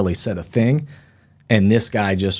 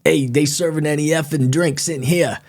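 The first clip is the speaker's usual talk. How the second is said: put-on higher, brash Northeastern US accent; mock Northeastern bar regular, pitched up; 6 semitones higher than usual. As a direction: imitate a pushy Northeastern guy at the bar. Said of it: "He's got an accent and everything".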